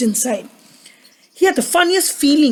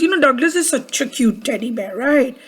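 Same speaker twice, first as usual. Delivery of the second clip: plain reading voice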